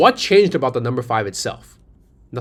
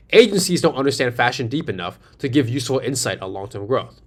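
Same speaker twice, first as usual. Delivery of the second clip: ordinary talk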